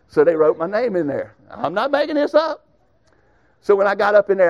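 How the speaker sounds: usual speaking voice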